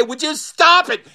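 high, fed-up demand; exasperated scolding. Acted. Queen scolds laughing men.

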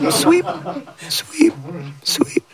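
high, clipped sweeps of a grumpy aunt; crabby aunt sweeping. Acted.

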